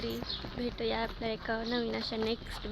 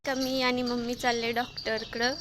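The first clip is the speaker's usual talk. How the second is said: normal talking voice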